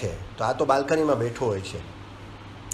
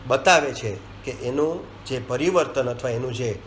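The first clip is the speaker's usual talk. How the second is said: usual speaking voice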